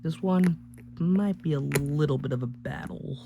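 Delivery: ordinary talk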